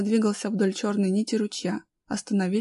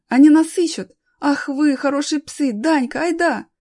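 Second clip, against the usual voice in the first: high, loud, elated exclamations; acted boyish jubilation; overjoyed; 6 semitones higher, 7 dB louder than usual. A narrator's reading. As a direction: praise the dogs and urge a friend on. Boy rejoices at rescue.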